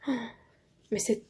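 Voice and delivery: whispered "oh" of delight; admiring whisper. Real, not acted. Vlogger whispering in awe at the envelopes' craftsmanship. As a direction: breathe it out in awe.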